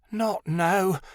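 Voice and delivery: mild high moan. A narrator's reading. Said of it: "she moaned".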